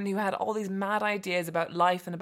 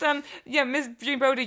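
normal talking voice

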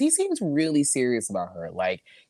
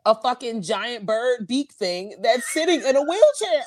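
usual speaking voice

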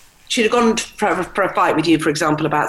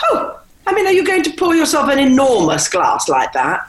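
much higher, louder mock scold from a mother; disapproving mother; 9 semitones higher, 4 dB louder than usual. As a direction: play the scornful mother, pitched well up. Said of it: "it would literally go".

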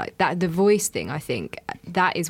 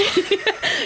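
high laugh. Real, not acted. Laughs at own school name.

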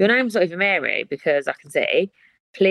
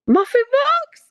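very high, loud question; shrieking disbelief; 4 dB louder, slower than usual. Real, not acted. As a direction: squeal at your own slip. Laughing at her own slip.

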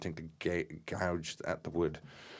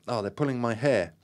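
higher cry, understated scream; mild scream; 5 semitones higher, 4 dB louder than usual. A narrator's reading. Gaynor is attacked in the séance, mild scream. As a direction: cry out, pitch raised, lightly acted. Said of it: "screams out".

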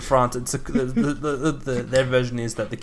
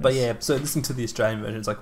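ordinary talk

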